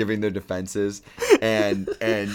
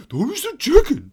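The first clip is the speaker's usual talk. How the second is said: much higher taunt; high mock quote; 5 dB louder than usual. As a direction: taunt in a squeaky voice. Quoting movie line.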